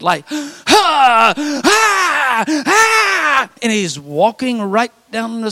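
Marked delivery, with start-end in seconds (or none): 0.3-3.5 loud high mock panting breaths; imitated hard panting. Pastor imitates the axe man's panting, loud and high. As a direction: puff out loud gasping breaths like a frantic man. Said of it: "he's breathing very hard".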